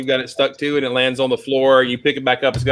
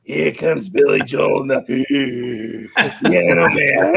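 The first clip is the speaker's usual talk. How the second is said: gravelly smoker imitation; slower than usual. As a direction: drag it out like a raspy old DJ. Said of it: "she's so very gravelly".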